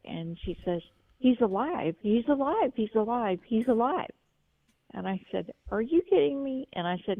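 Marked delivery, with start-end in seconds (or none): 1.2-4.1 higher and louder, repeating the joyful news; excited retelling. Retold or imitated. Caller retells the daughter shouting the dog lives, imitating excitedly. 5.7-6.7 much higher and louder, astonished; surprised. Retold or imitated. Caller reacts to hearing the dog revived, astonished and louder.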